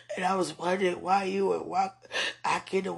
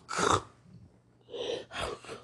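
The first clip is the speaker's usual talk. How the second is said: ordinary talk, nothing marked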